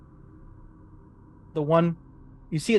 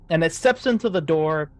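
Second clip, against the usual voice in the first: normal talking voice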